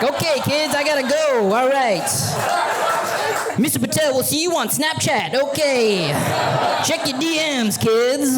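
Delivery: high, hasty cheerful farewell; teacher bluffing a quick exit to the kids, high and hasty; hurried goodbye. Retold or imitated. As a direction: rush out pretending all is fine. Hurried exit after boss fires him.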